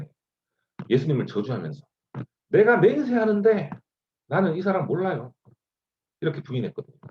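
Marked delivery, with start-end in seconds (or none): 2.5-5.3 swearing denial. Acted. Peter to the crowd, swearing he doesn't know Jesus, heated. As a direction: insist hard that you never knew him.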